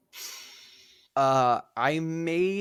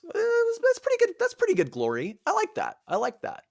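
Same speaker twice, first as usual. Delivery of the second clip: much higher, airy, self-satisfied voice; high mock Achilles. Teller mocks Achilles in a high, smug voice. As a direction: play him as a vain, easily pleased hero. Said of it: "he's like".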